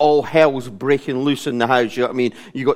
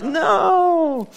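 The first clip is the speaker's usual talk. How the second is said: long, high drawn-out wail; hammed-up howl of protest; comic dismayed refusal. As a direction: stretch it out high in mock horror. Acted dismay at being given job.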